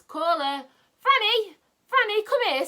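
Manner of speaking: calling out. Acted. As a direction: shout her name to bring her running. Rick calling Franny over.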